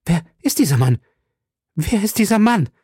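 high, alarmed question climbing on the repeat; panicked woman's voice, rising; terrified cry. A narrator's reading. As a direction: cry out in mounting dread. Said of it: "rief vom Schrecken fast zermalmt Milady".

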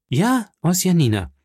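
high, emphatic JA, falling after; insistent confirmation. Acted. Offended visitor confirms to the journalist, insistent. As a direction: hit 'Ja' high and confirm impatiently.

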